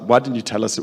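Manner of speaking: usual speaking voice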